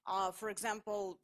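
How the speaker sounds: ordinary talk, nothing marked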